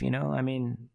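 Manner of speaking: ordinary delivery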